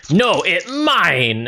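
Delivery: high, slow ogre protest; greedy ogre. Acted. First ogre grabbing at spilled gold, high greedy ogre voice. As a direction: claim it possessively, stretching the last word high. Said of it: "this ogre says".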